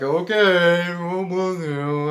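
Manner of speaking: slow, dragged-out mock acceptance; mock bemused okay. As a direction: stretch it out, baffled and dismissive. Mocks reaction to being blessed.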